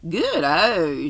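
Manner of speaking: Good-o said slowly and deliberately, each syllable placed; schoolboy saying Good-o with careful precision; careful pronunciation. A narrator's reading. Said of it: "pronouncing the word carefully".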